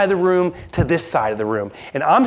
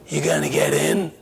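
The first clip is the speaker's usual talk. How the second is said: The Godfather; 4 dB quieter than usual. Retold or imitated. Scary stranger in the elevator, Godfather impression. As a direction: imitate a menacing old don. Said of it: "the only voice impersonation I can do is The Godfather".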